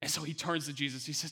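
ordinary talk, nothing marked

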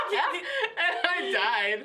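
laughing through it; cracking up while talking. Real, not acted. Laughing about the memory.

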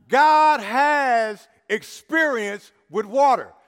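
slow, loud, every word weighted; emphatic repetition. Shown as a demonstration. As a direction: proclaim it slowly to one side of the church. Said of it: "I'm gonna say it on this side".

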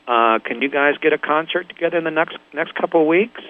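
lightly voiced record producer on the line; phone caller voice. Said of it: "this voice goes".

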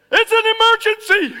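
very high, very loud shouted alarm; radio shout. Retold or imitated. Fire chief shouting into his radio, very high and loud. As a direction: yell it into the radio. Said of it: "he hits his radio".

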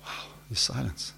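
very soft, awed near-whisper; hushed. Acted. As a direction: let it drop into the silence. Dramatizes the silence after the roar.

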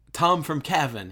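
high, loud retold call. Calling after Tom as he walks away, mock loud hail. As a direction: call after him as he leaves.

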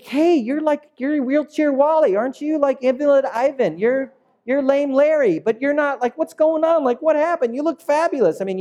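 high, excited gushing from the townspeople; amazed townspeople. Acted. Neighbours marveling at the healed man, high and gushing. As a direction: gush over the change like gossiping neighbours.

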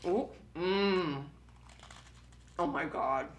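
ordinary talk

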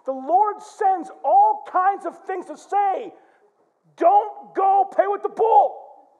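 slow, high, rhythmic preacher build; rising pulpit refrain; preaching. Real, not acted. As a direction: preach it in a building, drawn-out litany. Preaching refrain at full force.

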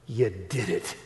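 laughing the words out; can't help laughing as you say it. Real, not acted. Preacher retelling his friend's conversion, laughing through it.

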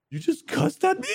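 high, disbelieving squeak; incredulous. Retold or imitated. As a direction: replay the shock of being cussed at. Retold disbelief at cussing therapist.

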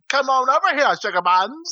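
high-pitched mock Southern accent; Stephen King. Wishing everybody talked so, hammed-up fake Southern accent. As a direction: copy a hammy, fake Southern movie line. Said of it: "I wish everybody would talk".

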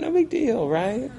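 high-pitched fake brush-off; mock nonchalance. Leader playing along with student's denial, mock nonchalant.

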